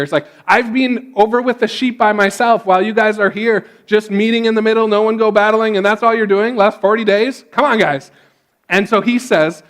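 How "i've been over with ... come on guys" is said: higher-pitched, exasperated rant; imitated frustration, pitched up and pressing; frustrated. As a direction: act out a fed-up complaint, running on quickly. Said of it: "really frustrated here".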